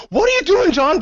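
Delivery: high, incredulous what are you doing; hammed-up disbelief; mock shock. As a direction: react appalled, pitched high. Teammates shocked at player.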